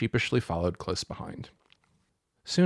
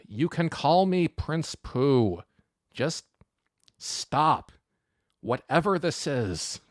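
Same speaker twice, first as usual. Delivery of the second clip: slow, higher-pitched, pleading surrender; drawn-out, long-suffering plea to stop; exasperated concession; 5 semitones higher, slower than usual. A narrator's reading. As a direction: give in word by word, fed up. Pu Yi wearily gives in.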